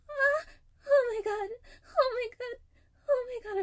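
high, loud, breaking cries; climax cries. Acted. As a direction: let it build into loud, high, ecstatic cries. Climax, crying out.

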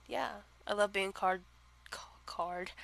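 soft breathy whisper. Real, not acted. Vlogger whispering while stumbling over the word. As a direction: whisper it under your breath.

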